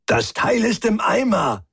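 high, strained exasperation; rushed-sounding irritated outburst; hassled and pissed off. A narrator's reading. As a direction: report bad news, harried and annoyed. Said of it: "zu gleichen Teilen gehetzt wie angepisst".

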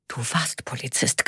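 a cutting whisper, nearly no voice. Acted. Abeba reminds Karl he is retired, whispered. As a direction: hiss the correction low and soft.

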